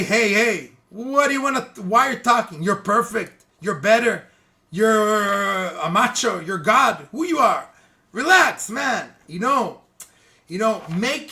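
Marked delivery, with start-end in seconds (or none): 0.0-9.1 acted indignant telling-off, rising to the end; mock confrontation. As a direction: challenge the gossiper, raised voice. Acted rebuke of a gossiper.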